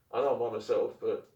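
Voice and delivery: ordinary delivery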